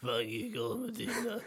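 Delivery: high, quiet strained put-on voice; put-on movie-line impression; impression of Cole Medina line. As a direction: mimic it softly in a high character voice. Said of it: "do an impression of what you think it sounded like".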